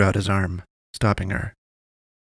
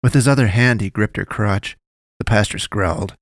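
plain reading voice

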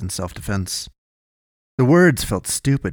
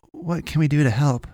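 higher-pitched, sudden question; sudden offer; 6 semitones higher than usual. A narrator's reading. Boy offering the wounded man help, suddenly. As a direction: come in suddenly in a raised pitch. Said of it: "Kalen said suddenly".

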